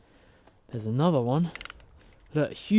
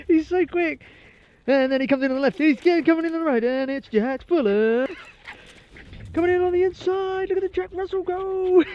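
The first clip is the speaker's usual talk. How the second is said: much higher, louder mock race commentary; excited commentary; 14 semitones higher, 3 dB louder than usual. Real, not acted. Cheering the dog tearing round the bunkers, commentator-style. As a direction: call it like a sports commentator, laughing.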